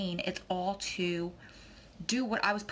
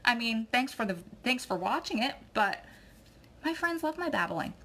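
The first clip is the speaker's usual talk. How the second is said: much higher, mock-indignant brush-off; sassy comeback; 6 semitones higher than usual. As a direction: put the rude commenter in his place, high and cheeky. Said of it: "And I'm like".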